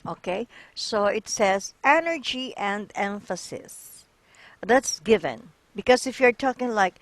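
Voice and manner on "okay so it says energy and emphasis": ordinary talk, nothing marked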